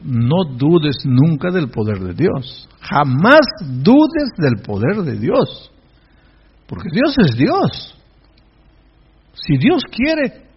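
slow, stretched words with swooping pitch; emphatic preaching. Real, not acted. Pastor assuring the congregation of God's power, emphatic preaching. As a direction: draw out each key word like a preacher.

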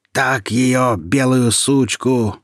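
loud, slow, savage jeer; gloating bandit roar. A narrator's reading. Bandit gloats over the dying girl, loud slow jeer. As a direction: yell it with cruel glee. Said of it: "заорал чернокожий разбойник".

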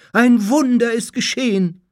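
high, loud jubilant shout; cry of jubilation. A narrator's reading. Followers see the master revive, jubilant cry. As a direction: shout the joyous news, pitched right up. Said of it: "wie ein Jubelschrei gleichzeitig aus allen Kehlen".

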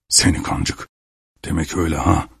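low, soft grumble to oneself; angry muttering. A narrator's reading. Peasant grumbling about the lady on leaving, muttering. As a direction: mutter the insult under your breath, almost voiceless. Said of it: "diye söylenerek".

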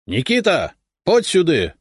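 high-pitched, mildly shouted summons; calling out. A narrator's reading. Donets summoning the Cossack Nikita, calling out. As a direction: call a man over from across the yard. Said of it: "крикнул Донец".

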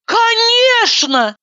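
high, slow, heartfelt cry; fervent exclamation. Acted. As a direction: exclaim it with feeling. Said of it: "с чувством воскликнула я".

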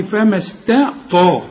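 slow فما استطاع, thin ت then dark ط; light ta, heavy ta. Shown as a demonstration. Teacher drills two different letters, light ta then heavy ta. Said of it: "أنت عندك ت وعندك ط".

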